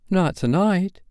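high-pitched, bewildered question; mildly acted childlike bewilderment. A narrator's reading. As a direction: ask it as a lost boy taken aback.

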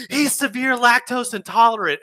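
high, loud mock outrage; alarmed scolding. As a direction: yell it as the horrified lady. Lady scolds him in story.

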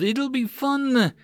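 high, slow, gleeful teasing voice; playful old headmaster. Acted. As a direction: savour it like a delighted child. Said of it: "Thorn smiled".

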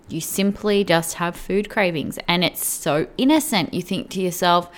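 normal talking voice

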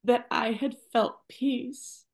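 holding back tears while she speaks; getting emotional. Real, not acted. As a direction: voice high and tight with real emotion, near tears. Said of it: "oh, I'm getting emotional".

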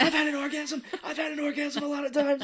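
very high, strained mock protest; Mac overreacting. Choking Dee over her taunt, hammed-up overreaction. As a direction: squeal it in laughing, overblown indignation. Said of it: "reminds me of Mac overreacting".

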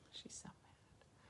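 unvoiced whispered aside. Real, not acted. Whispering to listeners about the mad dog, whisper. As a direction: breathe it as a secret.

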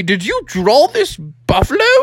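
high, bouncy dad question; voiced storybook dad. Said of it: "And Froggy's like".

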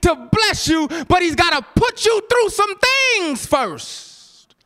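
high, slow, sung preaching cry; preaching whoop. Acted.

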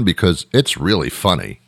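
ordinary talk

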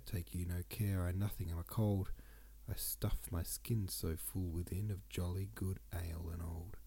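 usual speaking voice

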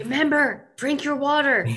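high squeaky little nag; nagging reminder voice. Pretend. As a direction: hiss it like a pesky reminder in your ear. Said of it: "someone kind of walking alongside you being like".

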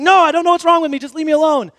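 high, loud, frantic plea to be left alone; desperate, shaken boy; panicked. Retold or imitated. The scared boy tells his friend to leave him alone, panicking. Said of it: "And just starts panicking".

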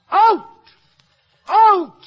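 outcry. Acted. Player's passionate speech, crying out.

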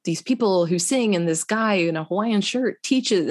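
normal talking voice

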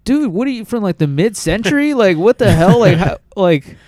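high-pitched, incredulous ribbing; building from high to loud, baffled exasperation; mock disbelief. Podcaster ribbing people who see gargoyles, incredulous.

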